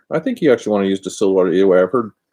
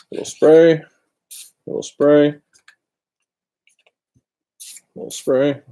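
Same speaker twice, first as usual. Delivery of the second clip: higher, louder sing-song call of each spray; spray callout; 7 semitones higher, 4 dB louder, slower than usual. Pretend. Narrating each spray to viewers, sing-song callout. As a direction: announce every spray to the viewers.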